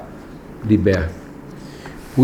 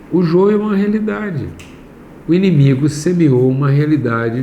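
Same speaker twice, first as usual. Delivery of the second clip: raised, higher-pitched preacher's voice; insistent, high preaching pitch; emphatic preaching; 5 semitones higher than usual. Real, not acted. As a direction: drive the point home from the pulpit. Raised preaching against plain teaching.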